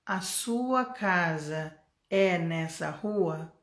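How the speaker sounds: very slow, word-by-word learner speech; unnaturally slow. Shown as a demonstration. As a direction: drag out every word like a slow language drill. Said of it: "tem gente que aprende assim".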